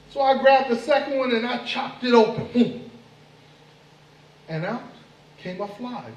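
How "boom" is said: coconut chop noise. Acted. Second coconut chopped.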